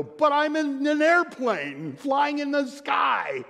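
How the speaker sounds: high, loud, slow shout of wonder; a kid at the fair shouting at the sky; childlike wonder. Retold or imitated. Preacher acts himself on a plane, awed childish shout. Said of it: "I look around and go".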